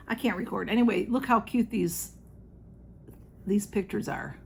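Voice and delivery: ordinary delivery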